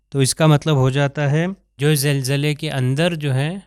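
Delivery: ordinary delivery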